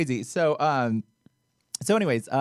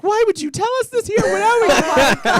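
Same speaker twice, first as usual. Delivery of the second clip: high, loud squealed protest; retold frantic squeal, very high-pitched; mock squeal; 8 dB louder than usual. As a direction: squeal it in overwhelmed disbelief. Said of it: "went from talking to squeal".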